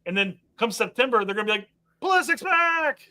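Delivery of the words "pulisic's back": mock cheer. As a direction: cheer it like a fan. Imitating team's cheer.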